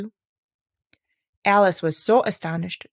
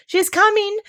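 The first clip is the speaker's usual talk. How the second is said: very high, louder lark call; excited cry; 6 dB louder than usual. A narrator's reading. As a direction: shout the alarm with excitement. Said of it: "cried the larks".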